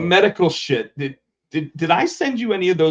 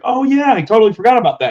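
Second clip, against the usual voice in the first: higher and louder, breezy recall; airy surprise; 8 semitones higher, 7 dB louder, faster than usual. Retold or imitated. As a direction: toss it off like it just came back to you. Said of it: "he's like".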